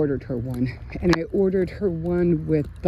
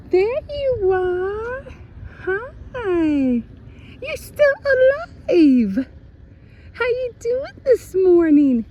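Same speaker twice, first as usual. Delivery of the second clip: much higher, cooing pet voice; 5 dB louder than usual. Real, not acted. Greeting the cat she found, high cooing baby talk.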